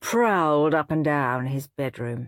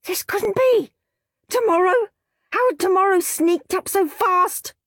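much higher, alarmed disbelief; panic; 14 semitones higher, 5 dB louder than usual. A narrator's reading. Henry realising the contest ends tomorrow, panicked. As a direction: gasp it out in dismay.

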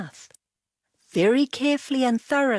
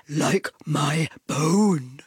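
Dog's moaning voice; 4 semitones lower, slower than usual. A narrator's reading. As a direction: moan it out as the grumpy dog. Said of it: "interrupted Dog".

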